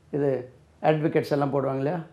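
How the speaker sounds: normal talking voice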